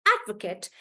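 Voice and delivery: "advocate" said AD-vuh-kut, falling away; strong AD, reduced "kut" in "advocate"; noun stress. Shown as a demonstration. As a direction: stress the first syllable of "advocate". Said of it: "then the pronunciation becomes".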